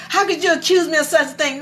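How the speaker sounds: quick, high-pitched offended comeback; imitating an affronted wife, rattled off; indignant retort. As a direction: fire back the protest fast and high. Mother's retort repeated.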